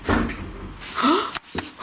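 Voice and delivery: a sudden, breathy unvoiced gasp; sharp gasp. Real, not acted. Ghost hunter startled in the house, gasping. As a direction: gasp as if something just moved.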